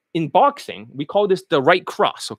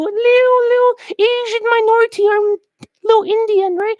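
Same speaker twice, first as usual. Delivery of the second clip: very high, squeaky little-guy voice; mock him in a tiny falsetto; little boxer; 14 semitones higher than usual. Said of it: "now you got a small little boxer".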